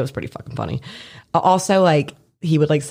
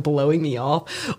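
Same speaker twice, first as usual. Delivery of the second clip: normal talking voice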